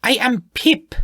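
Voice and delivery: put-on high, annoyed, with a clear Spanish accent; stronger Spanish accent. The gnome repeats her name, annoyed, Spanish accent. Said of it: "her Spanish accent more clearly heard this time".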